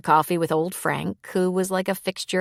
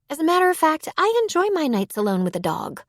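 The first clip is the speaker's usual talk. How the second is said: bartender Sid, pitched higher, teasing; a light female voice, pointedly independent; Sid's voice; 9 semitones higher, faster than usual. A narrator's reading. Bartender Sid answering Dakota in a higher teasing voice. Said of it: "she said".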